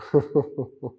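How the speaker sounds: brief burst of amused laughter; short chuckle. Real, not acted. Teacher doubting they will implement it, short chuckle.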